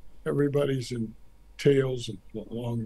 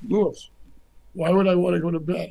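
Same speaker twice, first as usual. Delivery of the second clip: higher, louder and quicker, a mock-eager objection; excited protest; 5 semitones higher, 5 dB louder than usual. To the ambassador, refusing to leave the party: higher, louder, excited. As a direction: play the delighted president who won't leave.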